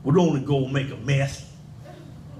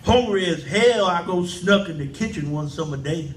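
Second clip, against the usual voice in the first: normal talking voice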